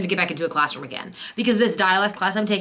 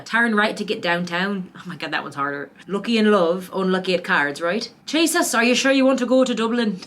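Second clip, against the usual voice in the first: practice sentences in a student's Western Irish accent, laughing at the end. Shown as a demonstration. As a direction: read the drills in Western Irish, breaking into a laugh. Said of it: "that's my Western Irish".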